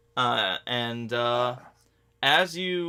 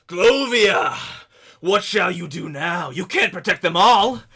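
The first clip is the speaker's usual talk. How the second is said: loud, raised taunt roared from afar; roaring villain; 6 semitones higher, 9 dB louder than usual. Acted. Laszlo taunting from cliffs above village, roaring voice. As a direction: roar it down from the clifftop. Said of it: "you hear a roaring voice echoing down".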